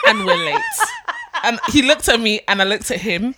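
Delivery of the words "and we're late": laughing. Retold or imitated. Pleading with driver, laughing.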